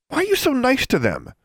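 high-pitched indignant demand. A narrator's reading. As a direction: demand an explanation, pitch shooting up. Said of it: "Alexandra demanded".

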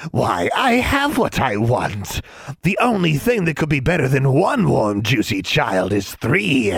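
high, slow creature voice; gloating swamp creature. Acted. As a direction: savor every word like a monster.

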